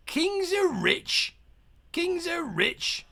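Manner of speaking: high, slow sing-song greedy pirate; greedy sailor. Acted. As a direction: croon it with greed.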